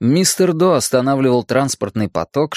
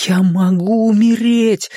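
high, drawn-out cry of horror; terrified cry; 10 semitones higher, 4 dB louder, slower than usual. A narrator's reading. Richie's mind realises he could die, terrified cry. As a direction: pitch way up, slow, in wild terror. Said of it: "в диком ужасе закричал его рассудок".

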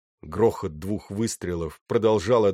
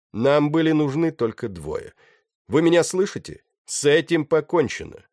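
high, loud address to a crowd; calling out; 7 semitones higher, 5 dB louder than usual. A narrator's reading. Pirate to the captives on deck, calling out. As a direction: project over the noise, pitch raised.

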